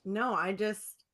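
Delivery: ordinary delivery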